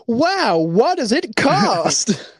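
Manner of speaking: put-on high, slow, awestruck kid voice; wide-eyed infomercial sidekick. Sidekick marvelling to the host in a mock infomercial, high and slow. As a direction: gush like a naive sidekick on an eighties tape. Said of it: "infomercial style".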